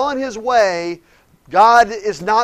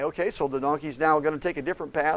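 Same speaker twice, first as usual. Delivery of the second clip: usual speaking voice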